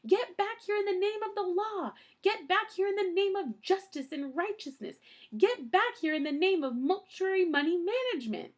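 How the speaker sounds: high, loud shout after them. A narrator's reading. Mister Poe yelling after fleeing Count Olaf, high shout. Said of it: "Mister Poe shouted".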